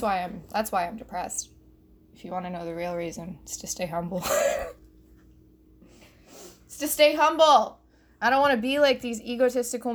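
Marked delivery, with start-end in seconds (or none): 2.1-4.2 low, soft and quick admission; quiet confession. Acted. Comic confiding the real reason, quiet confession. 6.7-7.8 high, loud proclamation; loud declaration. Acted. Comic proclaiming the reason to listeners, loud declaration. Same line belted after laughing.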